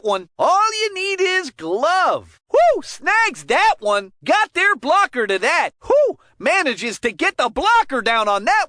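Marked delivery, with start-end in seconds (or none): 2.5-2.8 high, loud whoop of delight; a quick hockey-announcer whoop; excited whoo. Acted. Goalie save excites announcer. 5.9-6.2 high, loud whoop of delight; a quick hockey-announcer whoop; excited whoo. Acted. Another save excites announcer.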